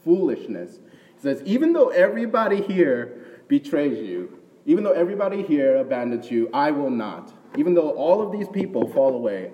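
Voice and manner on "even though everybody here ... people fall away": louder, higher, pushing boastful vow; a brash disciple blurting out his loyalty; hammed-up cocky boast, loud and high; hot-headed Peter. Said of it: "in his hot-headed foolishness".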